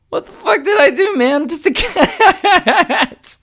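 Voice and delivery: very high, loud voice for a protesting pet; bewildered cat, through laughter. Retold or imitated. Man voicing his innocent cat protesting, laughing. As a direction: voice the wronged cat while cracking up. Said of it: "he was looking at me going".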